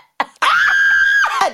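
high, loud real cackle; burst of laughter. Real, not acted. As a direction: crack up at your own verse. Laughing at her old rap.

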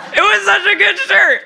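words squeezed out through high laughter; laughing. Real, not acted. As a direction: laugh as you say it. Laughing over a lost shirt.

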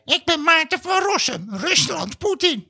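very high squeaky mock voice; mocking impression. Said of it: "En dan ga jij".

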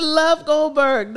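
slow, laughing through the name. Real, not acted. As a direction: crack up on the name.

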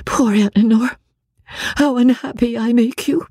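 put-on high, sorrowful young sister's voice; unhappy Marianne.

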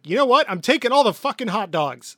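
loud, high, commanding rant; exasperated King Dedede. Acted. King seizing hot dogs to ration them, exasperated. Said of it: "King D D D is like".

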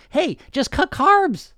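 high, slow, hammed-up sales pitch; mock diet pitch. Mocking the diet industry to listeners, a slow high hammed-up pitch. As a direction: sell it like a carnival barker, hugely overblown.

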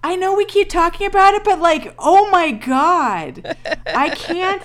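high, loud, drawn out at the end; overwhelmed gushing. Real, not acted. Co-host raving about the actor in tights, overwhelmed gushing. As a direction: gush helplessly, stretching the final exclamation.

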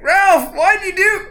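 very high, loud wail of betrayal; hammed-up anguished protest; dying bandit cry. Shot bandit crying out to comrade Ralph, anguished. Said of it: "It's like".

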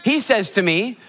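usual speaking voice

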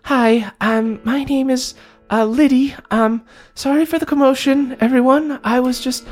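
high, timid little voice; nervous, apologetic kid creature; little bullywug. Acted. Young bullywug explaining herself to Ma Goblin, high and timid. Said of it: "looks up and goes".

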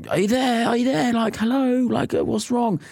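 high, urgent call through a locked door. Retold or imitated. Husband at the neighbour's door calling out, high and urgent. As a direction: call out to someone behind a door. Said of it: "we're going".

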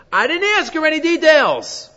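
high, loud self-justification; protesting. Acted. Adam explaining himself to Hashem, high loud protest.

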